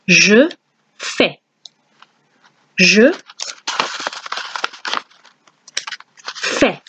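je fais with a silent s, zhuh feh; French je fais. Shown as a demonstration. Teacher showing je fais has no s sound. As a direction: model je fais for learners. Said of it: "we're going to say I do".

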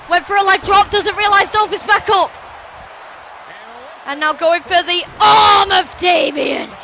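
loud, high-energy wrestling commentary; breathless-paced ringside hype, punching key words; excited play-by-play. Acted. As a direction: call the big moves with shouted peaks. Live wrestling match commentary.

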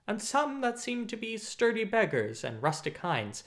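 ordinary delivery

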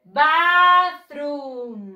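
Bathroom sung out long and loud, stressed on "bath"; model pronunciation. Shown as a demonstration. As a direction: call out Bathroom for the class to repeat.

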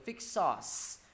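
FIX SAUCE with a clear ending hiss; with final s. Shown as a demonstration. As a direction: hold the s at the end of SAUCE. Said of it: "hướng dẫn mọi người đọc là".

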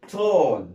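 tall said loud as tol, falling; loud classroom model of tall; model pronunciation. Shown as a demonstration. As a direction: pronounce tall for students. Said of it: "تي اي ال ال بتتنطق".

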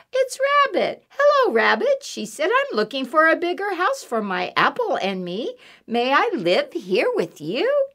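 high, loud, bouncy mouse voice; eager little mouse. Acted. Mouse asking Rabbit for a home, eager high voice. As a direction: pipe up brightly as a hopeful little mouse.

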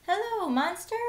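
usual speaking voice

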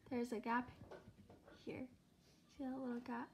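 finger in mouth; distorted lip sounds; lip pulled down by finger. Real, not acted. Seen 0: finger pulls lower lip to show braces; again 7.4–12.3.

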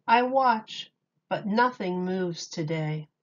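lively picture-book read-aloud with big openings; reading to children. Acted. Miss Julie reading Dr. Seuss to children, animated storytime voice. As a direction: read it like storytime for little kids.

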